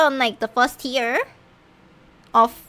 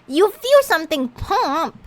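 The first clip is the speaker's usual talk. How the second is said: louder, higher disbelieving echo; surprised repeat; 6 semitones higher, 5 dB louder than usual. Real, not acted. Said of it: "Wait, what pops inside the human body?".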